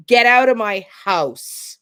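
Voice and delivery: no mistaking it, push it down; downward inflection. Shown as a demonstration. Coach showing a homeowner ordering someone out, firm fall. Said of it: "There is no mistaking".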